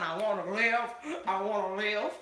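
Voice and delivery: mock plea. Mock dramatic plea to live.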